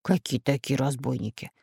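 guarded, subdued query; wary. A narrator's reading. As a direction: ask it cautiously, voice held down. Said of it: "насторожился профессор Пыхтелкин".

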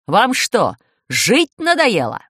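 loud, slow angry shout; shouting driver. A narrator's reading. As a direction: yell out of the window. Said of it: "закричал шофёр автобуса".

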